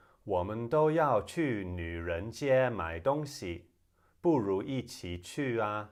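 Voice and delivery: slow, carefully enunciated Mandarin; Mandarin demo. Shown as a demonstration. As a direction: recite slowly in clear Mandarin for learners. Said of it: "And in Mandarin".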